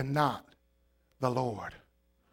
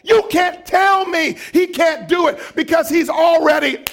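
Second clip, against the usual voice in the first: loud, high shouting sermon; full-force preaching; 13 semitones higher, 10 dB louder than usual. Acted. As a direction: preach it hard to the congregation.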